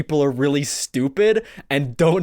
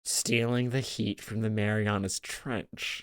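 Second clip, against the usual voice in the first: quiet; 6 semitones lower, 12 dB quieter than usual. Real, not acted. Finishing line, dropping away.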